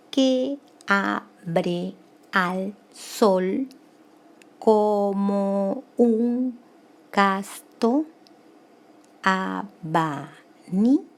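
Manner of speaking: slow, drawn-out syllables held evenly. Shown as a demonstration. Teacher reading the verse to count it grammatically, syllable by syllable. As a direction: stretch every syllable of the verse separately. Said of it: "Vamos a contar gramaticalmente".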